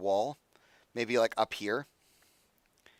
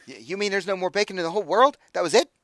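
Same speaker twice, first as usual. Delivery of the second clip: high, fast, horrified imp squeak; aghast imp; 7 semitones higher, 5 dB louder, faster than usual. Acted. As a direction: panic like the world just ended. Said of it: "he's like".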